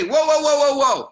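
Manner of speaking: high, loud panicked whoa; alarmed shout. Retold or imitated. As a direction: yell it like your brain panics. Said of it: "you say".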